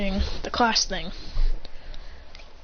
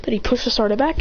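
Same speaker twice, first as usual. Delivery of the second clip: usual speaking voice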